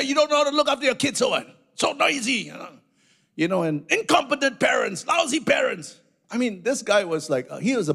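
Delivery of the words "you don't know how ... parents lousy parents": high, fast, shouted berating; angry rant. Retold or imitated. Passenger yelling at the pastor, mock angry shout. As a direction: berate the parents like a bully. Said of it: "at the top of his voice".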